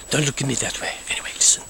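secretive whisper to the camera; hushed aside. Acted. A count whispering secrets to his viewers. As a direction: lean in and whisper it.

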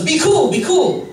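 high-pitched, urgent retold insistence. Stranger tickling him at a bar, urgent insistence.

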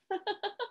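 soft, high giggle; short run of quiet ha-ha; light giggle. Pretend. Reader teases children who must not laugh, giggling. Asks whether listeners laughed.

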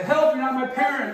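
loud, high shout for help; yelled call for help. Shown as a demonstration. Coach showing child how to yell for help, loud high yell. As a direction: yell it so the whole store hears. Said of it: "Try to keep yelling".

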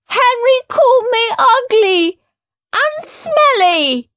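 high, loud weepy complaint; tearful wail. Acted. Peter telling on Henry to Mum, crying. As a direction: whine it through tears, slowing at the end. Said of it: "wailed Peter".

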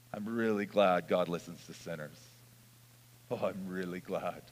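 low, soft, sincere aside; quietly heartfelt. Real, not acted. Preacher confiding gratitude that God listens to sinners, quietly heartfelt.

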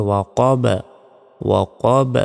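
"ওয়াকব" with a pushed, echoing final b; "ওয়াকব" ending in a popped b; qalqalah bounce. Shown as a demonstration. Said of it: "বলতে হবে".

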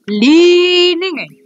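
dramatic announcement. Pretend. Dramatic game move.

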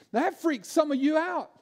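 usual speaking voice

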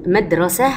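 madrasah, no t at the end of مدرسة; pausal form with h. Shown as a demonstration. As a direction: stop on مدرسة, turning its ة into h. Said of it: "أنا كده وقفت عليها ممكن أقول مدرسة".

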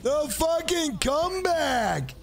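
comeback cheer. Real, not acted. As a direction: yell it out as the comeback lands.

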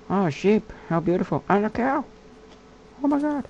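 high-pitched gush over the animals; excited squeal. Real, not acted. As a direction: squeal with delight at the sheep. Squealing at sheep and cow.

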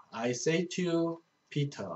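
each English word read slowly and clearly; slow verse reading. Shown as a demonstration. Teacher reading a verse to Korean students, slow and clear.

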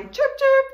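very high, loud chirping; bird chirps. Shown as a demonstration. Teacher voicing the birdie in the kids' song, high chirps. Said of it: "the birdies can be chirp".

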